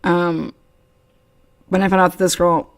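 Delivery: usual speaking voice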